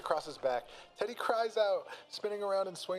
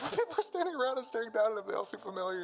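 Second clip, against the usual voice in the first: excited; 7 semitones higher, faster than usual. Acted. Narrator gushes over heavenly vision.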